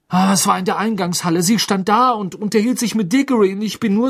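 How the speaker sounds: put-on high, loud, agitated teen; distressed Ron. Ron telling Harry his embarrassment, agitated and high. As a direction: babble it out in horror.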